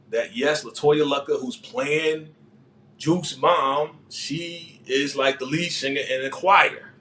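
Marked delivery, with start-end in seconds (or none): none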